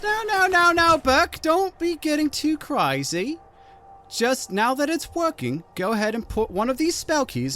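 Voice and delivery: put-on a shrill old hag scolding; the hag voice pushed high and loud. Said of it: "She says".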